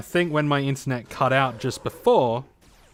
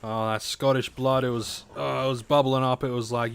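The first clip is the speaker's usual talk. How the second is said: normal talking voice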